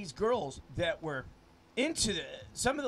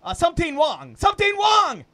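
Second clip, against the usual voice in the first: shouting; 10 semitones higher, 13 dB louder than usual. Acted. Acted alarmed quote.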